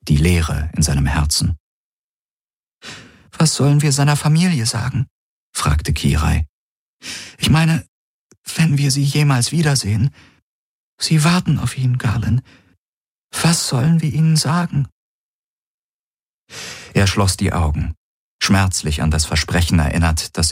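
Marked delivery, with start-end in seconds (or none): 3.4-14.9 put-on much higher, anxious young woman; desperate, grieving plea; distressed young woman. Kirai distressed over the dead friend.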